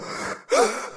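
sharp, sudden intake of breath; acted startled gasp; shocked gasp. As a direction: gasp as if cut short. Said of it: "And I go".

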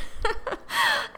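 short high breathy laugh; wry laugh. Real, not acted. Host laughing at her own panic attacks, short airy laugh. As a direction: laugh at how chaotic your life is.

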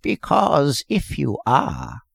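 put-on slow, cracked, querulous old voice; creaky, peevish old-timer; querulous old man. A narrator's reading. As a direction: complain like an ancient man. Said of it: "went on the old man querulously".

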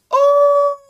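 very high, loud squealing "Oh"; a sudden delighted shriek; high-pitched burst of excitement; excited squeal. Real, not acted. Excited gasp at the princess.

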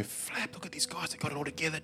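under the breath; muttered to himself, very soft; hushed. Retold or imitated. Inner thought, hushed to self.

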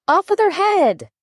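high-pitched, imperious queen's cry; narrator's restrained shout of a furious queen; shouted command. A narrator's reading. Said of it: "shouted at the top of her voice".

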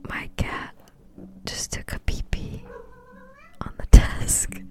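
shocked hushed whisper. Real, not acted. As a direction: whisper it in disbelief. Shocked as cat pees on desk.

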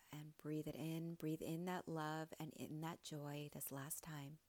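soft, unhurried meditation voice with long pauses; hushed, soothing coach leading breathwork; guided meditation. Real, not acted. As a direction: gently guide listeners into stillness.

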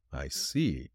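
low, quiet, grave acknowledgement. A narrator's reading. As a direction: say it gravely, dropped low and soft. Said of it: "said Nick gravely".

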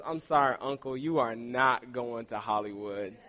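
low, soft mock verdict; talent show judge. Little niece judging her uncle's singing, mock solemn. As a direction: imitate a judge gravely letting someone down. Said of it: "if she was on American Idol".

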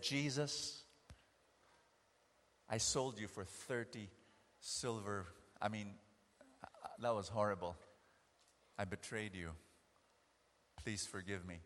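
soft, low, sorrowful confession to Jesus; a hushed, broken apology; quiet repentant plea. Acted. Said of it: "and said".

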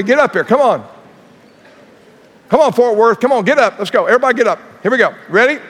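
loud, high rally to stand; rallying call. Real, not acted. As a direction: fire up the crowd to jump up.

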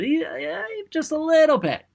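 squeaky, very high coaxing; playful tiny voice. Acted. Speaker coaxing listeners to buy in, playful high squeak. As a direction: pitch it way up for a teeny concession.

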